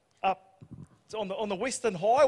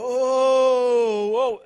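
long, raised whoa; horse whisperer impression; 4 semitones higher, 6 dB louder than usual. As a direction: act out calming horses with a stretched call. Imitating partner's calls to horses.

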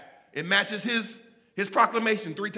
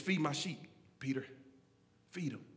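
softly spoken charge; quiet, low command; 6 semitones lower, 9 dB quieter than usual. Acted. Jesus at the shore commissioning Peter, quiet and low. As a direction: say it low, with gaps between phrases.

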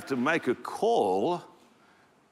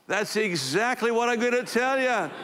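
Ann's bold reply; 9 semitones higher than usual. Acted. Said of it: "And Ann goes".

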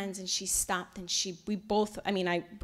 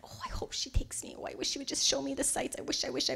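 inner wish; 5 semitones higher, 5 dB quieter, faster than usual. Retold or imitated. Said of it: "I'm inside going".

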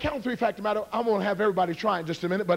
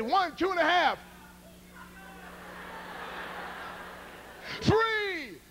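high, drawn-out count; shouted count-in; 11 semitones higher, 5 dB quieter, slower than usual. Real, not acted. Karate man cueing the crowd again, high calling count. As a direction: call it out high, stretching the last number. Second teasing count-in for yell.

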